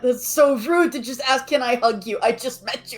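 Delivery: rising to a squealing cry; flustered, pitch climbing to a shout; overwhelmed by cuteness. Acted. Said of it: "Internal screaming commencing".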